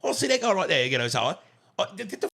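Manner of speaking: high, loud mock-cockney scoff; a hammed-up English dismissal; mock British accent. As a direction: sneer like a London hard man. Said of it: "I got London on my head".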